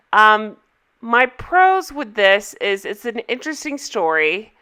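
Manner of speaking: normal talking voice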